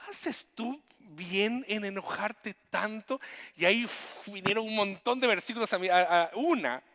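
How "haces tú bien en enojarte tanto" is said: slow, gentle divine question, higher; voice of God. Acted. Preacher voicing God asking angry Jonah. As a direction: ask it slowly like a patient God.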